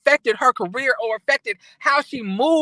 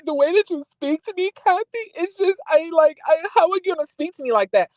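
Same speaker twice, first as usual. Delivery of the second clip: higher-pitched imitation of someone upset; mock upset complaint; 6 semitones higher than usual. Retelling a castmate's complaint to her sister, mock upset. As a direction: put on a high, hurt protest.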